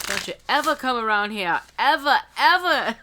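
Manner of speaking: put-on high, loud comic movie accent; mock warning shouted in a put-on accent, building; Chris Tucker. Reader copying the guy from Friday, high shouted accent. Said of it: "Chris Tucker, right?".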